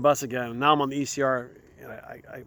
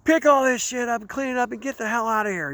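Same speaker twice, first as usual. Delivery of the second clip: high, harsh cop order; mock cop telling him to clear out; disgusted cop; 9 semitones higher than usual. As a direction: order him off like a fed-up officer. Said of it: "And he goes".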